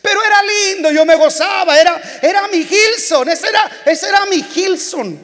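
real nostalgic outburst; emotional. Real, not acted. Fond memory, voice breaking.

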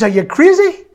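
high, loud indignant squawk; pig's outraged cry. Acted. Pig shouting at the chicken in outrage. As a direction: squeal it out in disbelief.

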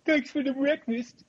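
high-pitched goofy comedian impression; Adam Sandler. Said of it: "he's doing an Adam Sandler voice".

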